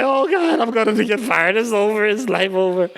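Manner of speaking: high hammed-up wail that settles; mock despair. Assistant fearing she'll be fired, hammed-up high despair. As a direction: overact a kid who thinks her job is over. Girl fearing firing, retold.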